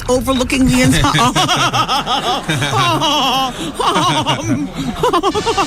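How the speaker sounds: high, laughing through the words. Real, not acted. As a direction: giggle it out, high. Laughing at her own joke.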